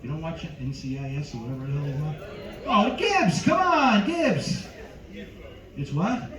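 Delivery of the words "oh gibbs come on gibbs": high, loud cry of disbelief; exasperated exclamation. Acted. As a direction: plead with the crowd at a raised pitch. Exasperated cry at crowd not knowing show.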